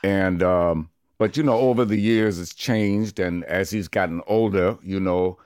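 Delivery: veteran narrator impression; measured, storytelling actor voice; Morgan Freeman. Shown as a demonstration. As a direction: talk like a seasoned film narrator. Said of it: "I think I could do Morgan Freeman".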